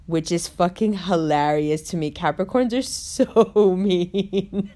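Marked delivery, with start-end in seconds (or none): 2.1-4.8 slow words dissolving into laughter; laughing. Real, not acted. Laughing at her brother's Capricorn joke. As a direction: laugh through the line.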